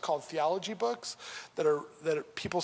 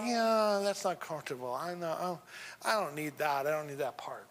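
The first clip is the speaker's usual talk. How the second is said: softer, shrinking away from it; a hushed, squeamish brush-off; reluctant mock voice; 4 semitones lower, 4 dB quieter than usual. Pastor mimics churchgoers refusing God's gifts, softly reluctant.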